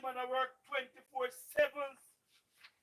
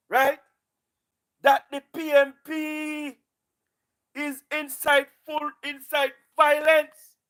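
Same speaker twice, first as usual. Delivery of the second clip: loud, fired-up campaign oratory that keeps pushing; fiery rally speech; 9 dB louder than usual. Acted. Party speaker firing up supporters against the opposition, loud rally oratory. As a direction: rouse the crowd at full volume, hammering each point.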